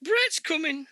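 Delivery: high, loud fan squeal of excitement; mimicking fans screaming that the star is coming; excited fans.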